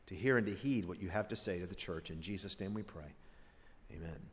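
soft, reverent prayer voice. Real, not acted. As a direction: pray quietly and humbly. Closing prayer before sermon.

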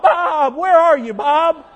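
loud call across the field for Bob. Acted. Sheep calls loudly for lost Bob, shouting. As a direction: shout his name into the distance.

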